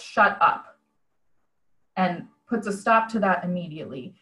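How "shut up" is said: mildly curt retold dismissal. Jesus shutting down his critical followers, curt. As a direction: shut it down briefly, without raising the voice. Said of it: "very curtly, he basically says".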